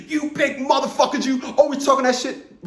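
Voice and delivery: high-pitched heckling, imitating an opponent; mock personal attack. Man retelling how a tattooed guy came at him personally, high mock taunt. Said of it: "he tried to coming at me personally".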